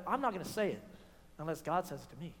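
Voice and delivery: prophet's quiet resolve; low hushed vow. Acted. Micaiah's vow voiced.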